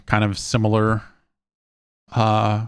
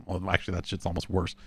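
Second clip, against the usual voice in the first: usual speaking voice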